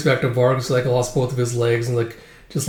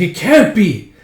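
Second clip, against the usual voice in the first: high, loud exclamation; dramatic high-pitched disbelief; shocked disbelief; 8 semitones higher, 7 dB louder, slower than usual. Acted. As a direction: cry out in acted astonishment. Acted shocked discovery.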